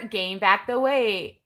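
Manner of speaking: ordinary talk, nothing marked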